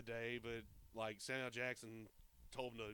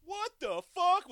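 a slow, drawn-out mock yell, higher and louder; 14 dB louder, slower than usual. Podcaster imitating a shocked offscreen bystander, yelling. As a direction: belt it out like a stunned passerby.

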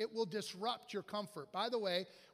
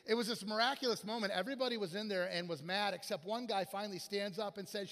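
ordinary delivery

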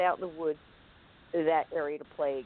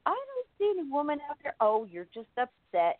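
mom's voice; 8 semitones higher than usual. Retold or imitated. Mom voiced in a story.

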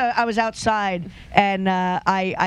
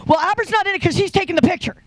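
mock fan voice; 8 semitones higher, faster than usual. Fans' comments voiced mockingly.

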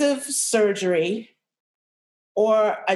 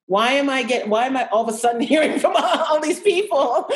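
higher and louder, a laugh breaking through; laughing disbelief; 6 semitones higher, 4 dB louder than usual. Real, not acted. Amazed that friends suddenly share fibroid stories, laughing. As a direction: laugh at the absurdity as you ask.

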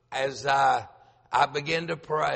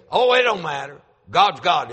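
gruff caller; 3 semitones higher, 9 dB louder than usual. Retold or imitated. Caller voiced in retold call.

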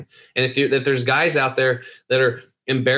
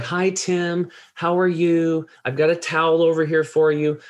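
higher, sweet sing-song greeting; mock-sweet welcome in a raised voice; imitating super-nice lady; 5 semitones higher than usual. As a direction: gush politely like a very nice massage therapist. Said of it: "She's a super nice lady".

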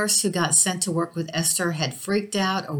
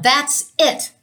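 raised voice, pitched up, fed up; fed-up outburst; 9 semitones higher, 5 dB louder than usual. A narrator's reading. Exasperated nurse snaps at the ghost out loud, raised fed-up voice. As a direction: burst out aloud, exasperated. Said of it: "I said out loud".

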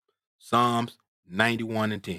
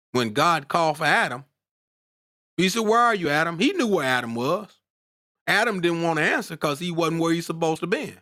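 worked up preaching; 7 semitones higher than usual. Real, not acted. Preacher retelling God's call to Adam, getting worked up. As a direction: pitch lifted as the sermon heats up. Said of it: "I'm getting worked up".

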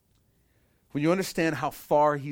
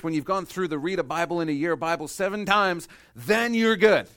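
higher, louder sarcastic climax; mocking checklist; 5 semitones higher, 7 dB louder than usual. Preacher mocking earning salvation, loud and sarcastic. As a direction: hammer the absurd checklist.

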